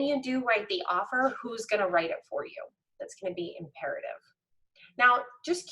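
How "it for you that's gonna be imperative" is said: whisper. Real, not acted. Coach stressing key point.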